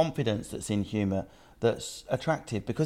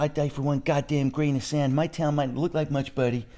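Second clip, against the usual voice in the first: hammed-up American accent, higher, patriotic; 4 semitones higher than usual. Comedian mocks an American boasting of his town, in a higher American accent. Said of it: "an American person would be".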